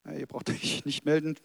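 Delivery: normal talking voice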